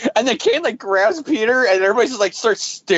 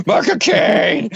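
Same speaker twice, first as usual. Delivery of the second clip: slow laughing punchline; slower than usual. Real, not acted. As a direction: crack up as you say the name.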